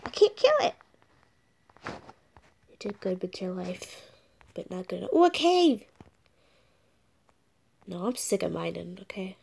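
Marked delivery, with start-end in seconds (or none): 0.0-0.7 high exclaim. Real, not acted. Fails to kill chicken. 5.1-5.8 excited surprise. Real, not acted. Spots a cave.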